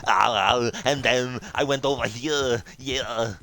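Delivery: lightly voiced Austrian action star, loud start; a hint of an exaggerated Schwarzenegger drawl; Arnold Schwarzenegger impression. Shown as a demonstration. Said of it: "Arnold Schwarzenegger's can be done exaggerated".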